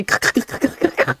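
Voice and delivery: high, choppy crackle sounds, laughing through them; crackling speaker noise. Retold or imitated. Streamer mimics her crackling speakers to chat, laughing, high crackle noises. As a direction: imitate a bad speaker crackling. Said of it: "dans les haut-parleurs, ça fait".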